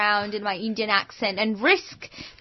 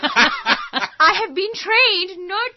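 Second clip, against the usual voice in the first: slow, higher-pitched Indian accent; 9 semitones higher than usual. Shown as a demonstration. Woman joking she was trained not to, Indian accent. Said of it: "do your Indian accent".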